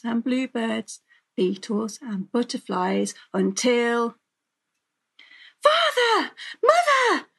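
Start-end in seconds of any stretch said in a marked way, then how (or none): 5.6-7.3 very high, loud calls for parents; urgent girlish cries; crying out. A narrator's reading. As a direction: shout for help as a frightened girl.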